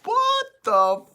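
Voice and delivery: very high, slow, stretched disbelief; mock stunned confusion, dragged out; drawn-out baffled what the fuck. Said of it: "And I'm like".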